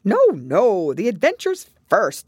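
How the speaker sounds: loud, high interruption; impatient. A narrator's reading. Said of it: "in an impatient tone".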